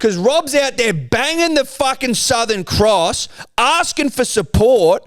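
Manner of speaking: high, slow rallying cry; hyped up. Acted. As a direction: pump up the crowd. Hyped rant about Rob.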